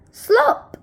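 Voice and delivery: high, loud "Slop!" sound effect; splashy slop. A narrator's reading. Reading to kids, the bucket lands: a high, loud "Slop!". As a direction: pop it out like a splash.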